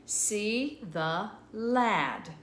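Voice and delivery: See the lad read word by word, very slowly; slow reading aloud. Shown as a demonstration. Teacher to children, reading the sentence slowly. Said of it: "reading the sentence aloud slowly".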